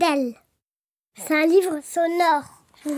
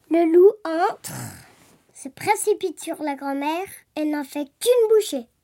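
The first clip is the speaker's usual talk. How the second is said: ordinary delivery, nothing marked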